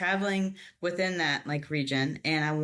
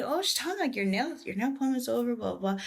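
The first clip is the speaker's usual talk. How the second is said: family voices; 7 semitones higher, faster than usual. Retold or imitated. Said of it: "they're asking me".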